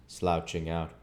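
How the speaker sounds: usual speaking voice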